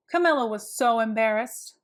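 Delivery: ordinary delivery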